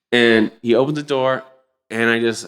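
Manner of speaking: ordinary talk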